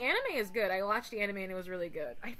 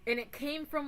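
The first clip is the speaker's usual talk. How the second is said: ordinary delivery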